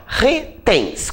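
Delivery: slow, separated "retens"; "retens" stretched into two even pieces; syllable by syllable. Shown as a demonstration. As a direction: sound out "retens" as re, pause, tens. Sounding out syllables to find stress.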